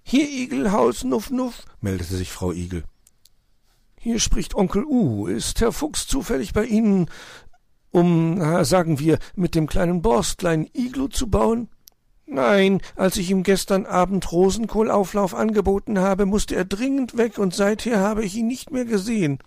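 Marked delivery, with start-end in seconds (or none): 0.0-1.6 high, snuffling hedgehog lady. Acted. Frau Igel meldet sich am Telefon, hohe Igelstimme. 4.0-11.7 lightly raised owl voice. Acted. Onkel Uhu fragt Frau Igel, Eulenstimme. 12.3-19.4 high, snuffling hedgehog lady. Acted. Frau Igel meldet sich am Telefon, hohe Igelstimme.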